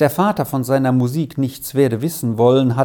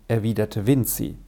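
ordinary delivery, nothing marked

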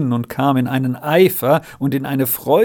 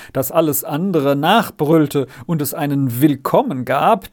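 ordinary delivery, nothing marked

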